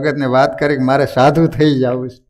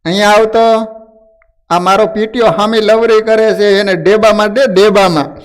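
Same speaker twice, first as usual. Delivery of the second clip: raised, higher-pitched acted yell; calling out; 5 semitones higher than usual. Angry wife summons her son against his father, calling out. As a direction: call out sharply for the boy, voice lifted. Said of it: "બૂમ મારીને બોલાયો".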